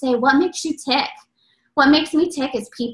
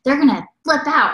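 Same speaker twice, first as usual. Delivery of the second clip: imitating an excited daughter; a lightly voiced, fired-up kid; 3 dB louder than usual. Daughter answering mom about pumpkin spice pancakes, fired up. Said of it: "all fired up".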